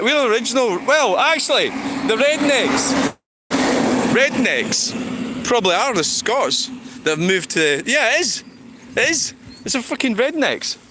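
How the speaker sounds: getting carried away with a wild claim; excited rant. Real, not acted. Vlogger ranting to chat, pitch climbing high and excited. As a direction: voice climbing high, lively and quick to laugh.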